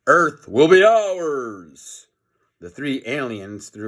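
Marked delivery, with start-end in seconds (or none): 0.1-2.1 slow, looming alien threat; villain alien voice. Acted. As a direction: draw it out like a gloating space villain. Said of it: "said Jennifer".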